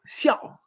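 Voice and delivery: xiao cut short with a dry fall; dry fourth tone. Shown as a demonstration. Teacher reminds learners of fourth tone, xiao dry and falling. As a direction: drop xiao sharply and stop. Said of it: "recordamos que es seco".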